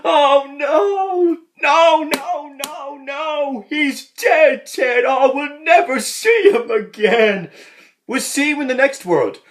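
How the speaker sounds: hammed-up sobbing priest; mock wailing grief. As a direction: wail over the dead man. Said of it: "he's like".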